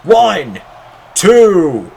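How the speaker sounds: high, loud count to a kickout; excited count. Real, not acted. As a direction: count it up and explode on no. Near fall count live.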